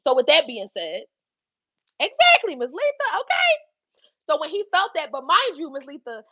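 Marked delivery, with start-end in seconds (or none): 2.0-3.5 high, loud squeal of agreement; emphatic exclaim. Real, not acted. Agreeing with Miss Letha, high excited exclaim. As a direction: hit it high and sharp.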